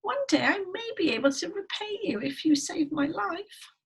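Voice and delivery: high, squeaky begging; pleading little mouse. Acted. Mouse bargaining with the lion, high pleading voice. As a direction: plead for your life in a tiny mouse voice. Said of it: "cried the mouse".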